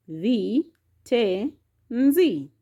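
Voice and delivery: "Vi, te, nzi" with short pauses; syllable by syllable. Shown as a demonstration. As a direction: count out "Vi", "te", "nzi" separately. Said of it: "has got".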